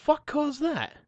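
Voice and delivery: slow, high-pitched retold astonishment; astonished disbelief. Driver seeing his shredded tire, astonished. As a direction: stretch it out high, utterly baffled.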